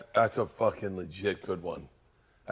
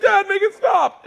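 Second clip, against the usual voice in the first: high, slow pleading shout, much louder; kids' whiny shout; 9 dB louder than usual. Retold or imitated. Kids begging their dad to stop the noise, high whiny shout. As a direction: wail it like a scared kid begging.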